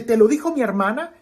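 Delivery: put-on a mild angry child accusing; angry boy. A narrator's reading. Boy angrily asking grandma if his sister told. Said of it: "el niño se enoja".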